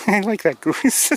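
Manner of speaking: high, loud, slow words through laughter; real laugh breaking into the words; tickled, laughing as you say it. Real, not acted. Laughing fondly at the goose.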